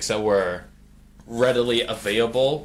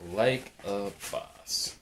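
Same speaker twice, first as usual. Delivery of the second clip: catchphrase stretched out very slowly; half-speed, deliberate delivery; slow drawn-out repeat; 2 semitones lower, 4 dB quieter, much slower than usual. Real, not acted. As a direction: draw each word out, unhurried.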